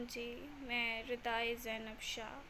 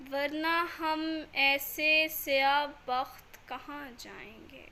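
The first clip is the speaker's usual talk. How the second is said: raised voice on each line's opening, falling away at its close; devotional poem recitation; 3 semitones higher, 9 dB louder than usual. Acted. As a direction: recite the salaam with measured pauses. (अकीदत भरा कलाम पाठ)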